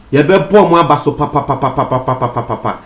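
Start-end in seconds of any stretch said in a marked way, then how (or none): 1.3-2.8 fading run of soft imitated gunshots "pa"; "pa pa pa" going softer and softer; mock gunshots. Telling viewers how police shoot robbers, fading mock gunshots.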